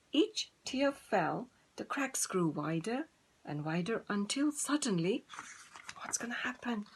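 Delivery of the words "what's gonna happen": whispered question to listeners; suspense whisper. Shown as a demonstration. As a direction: tease the suspense softly. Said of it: "teller whispers suspense".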